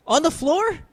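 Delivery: sudden high disbelieving question; shocked, voice jumps up; surprised. Real, not acted. Told Ange is on floor.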